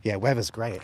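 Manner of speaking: ordinary talk, nothing marked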